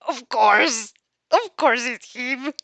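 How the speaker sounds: loud excited exclamation, slowing down. Acted. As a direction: exclaim it with delighted recognition. Character recognizes Bakugo excitedly.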